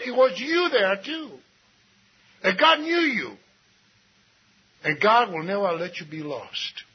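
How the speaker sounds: normal talking voice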